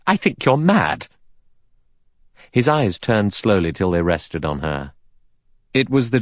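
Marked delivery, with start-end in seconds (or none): 0.1-1.1 angry cry. A narrator's reading. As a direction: fling it at him in anger. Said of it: "Vera cried angrily".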